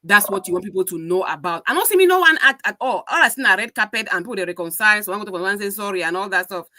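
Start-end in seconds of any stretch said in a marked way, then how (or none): none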